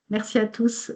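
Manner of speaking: ordinary talk, nothing marked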